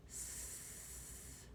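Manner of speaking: long hissing sss sound; drawn-out s like a snake. Shown as a demonstration. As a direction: hiss it out like a snake. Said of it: "I sound like a snake".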